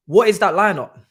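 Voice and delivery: loud exasperated demand; furious fan yelling at the lineup; angry outburst. Real, not acted. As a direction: bark it at the screen. Angry fan ranting at team selection.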